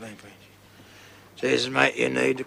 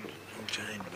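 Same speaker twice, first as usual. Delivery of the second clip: whisper; 3 semitones lower, 17 dB quieter, slower than usual. Acted. Despairing confession to his mate.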